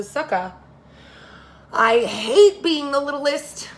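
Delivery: frustrated complaint. Acted. Little Hannah complains to Grandpa, loud frustrated whine. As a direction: stretch out the hate, fed up.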